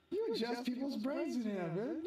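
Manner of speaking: high-pitched incredulous question; retold disbelief. Retelling disbelief at the claim.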